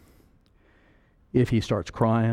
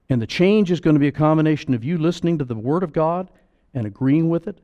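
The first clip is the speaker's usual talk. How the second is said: ordinary talk, nothing marked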